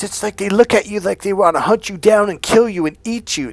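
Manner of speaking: hammed-up girlfriend complaining about men; imitating ex-girlfriend. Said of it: "She goes".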